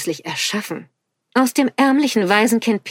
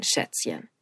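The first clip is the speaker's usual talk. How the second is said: low whispered threat; menacing whisper; 10 dB quieter than usual. A narrator's reading. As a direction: breathe the word softly and coldly. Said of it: "bedrohlich leiser Stimme".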